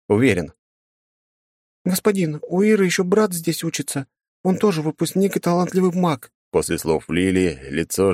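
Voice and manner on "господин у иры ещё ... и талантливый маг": put-on higher pleading girl's voice; earnest young assistant; young woman voice. Girl Lily pleads for brother.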